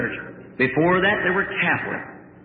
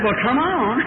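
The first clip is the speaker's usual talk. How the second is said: spry grandma calling; 8 semitones higher, slower than usual. Retold or imitated. Grandmother calls down from attic.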